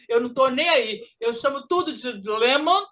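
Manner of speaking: ordinary talk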